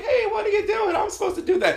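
very high, squeaky-pitched panic; hammed-up falsetto protest; mock frightened voice. Comic acts scared of a woman on the street, high-pitched.

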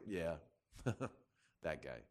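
low, very soft knowing murmur; conspiratorial aside. Acted. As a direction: share a quiet private dig at someone. Mocking aside to a friend.

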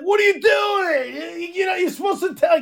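high, exasperated mock scolding; mock outraged yell. Acted outburst of Lori's dad, repeated.

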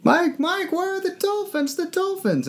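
high, panicked calling; frantic Kay voice. Retold or imitated. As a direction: shriek for the lost dolphins.

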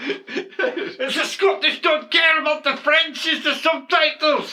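high, drawn-out mock Scottish accent. Co-host joking about subtitles in put-on Scottish accent. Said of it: "with that Scottish accent".

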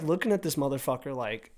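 ordinary talk

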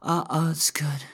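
high, loud ecstatic cries; climax cries. Acted. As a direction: cry out at the peak of pleasure.

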